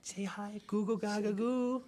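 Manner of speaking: put-on high, slow goo goo baby voice; baby talk. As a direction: coo like a baby. Speaks for the baby.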